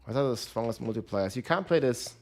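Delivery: ordinary delivery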